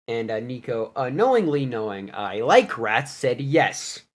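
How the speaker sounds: usual speaking voice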